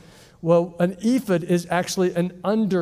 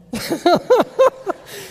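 mocking laugh; 12 semitones higher, 5 dB louder than usual. David laughing at Michal.

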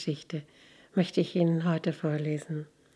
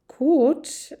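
higher, louder, stretched-out doubtful word; hesitant; 10 semitones higher, 5 dB louder than usual. Acted. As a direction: stall for an answer. Man is cornered by the question.

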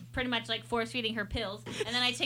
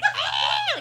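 imitated scream; 12 semitones higher, 4 dB louder than usual.

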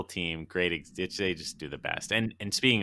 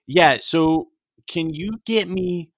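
high, louder put-on customer; 6 semitones higher, 6 dB louder than usual. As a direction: order fussily, playing the customer. Acting out the skit customer.

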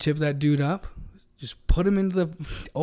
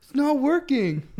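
put-on panicked kid; 11 semitones higher, 4 dB louder, slower than usual. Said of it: "I'm like".

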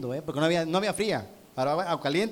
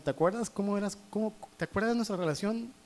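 higher and softer, tenderly reminding; God's voice; 4 semitones higher, 4 dB quieter than usual. Retold or imitated. God reminding the preacher in the shower, higher and softer. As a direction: gently recall the closeness of seven years ago. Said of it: "Dios me estaba diciendo".

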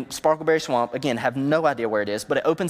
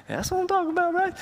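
very high, put-on squeal; comic high-pitched cheer; squeaky excitement; faster than usual. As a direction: mimic giddy excitement in a tiny high voice. Imagining friend's triumphant news.

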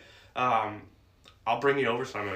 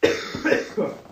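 harsh dry cough; coughing fit; 7 semitones higher, 3 dB louder than usual. Real, not acted. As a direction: cough hard into the mic. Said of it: "I'm coughing".

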